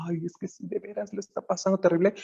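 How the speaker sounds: fast, hammed-up pitying whine; mock someone drowning in another's pain; overwhelmed pity. Teacher mocks overinvolved compassion, fast pitying whine. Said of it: "te vuelques movido por la compasión".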